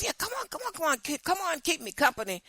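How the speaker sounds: high, wheedling plea from a buddy; coaxing friend. Retold or imitated. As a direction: sweet-talk her into the trip. Friend coaxing her to ride along.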